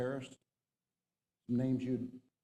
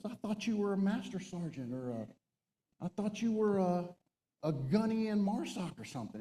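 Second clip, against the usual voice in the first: high-pitched mock disbelief; bewildered protest; 8 semitones higher than usual. Enlisted man mistook officer for a sergeant, bewildered. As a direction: imitate a man totally thrown by the news. Retold E-8 stunned by officer's rank.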